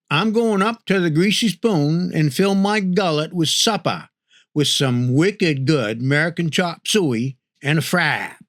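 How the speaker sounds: lightly voiced Maine local; Maine accent. Shown as a demonstration. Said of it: "how folks say it up here".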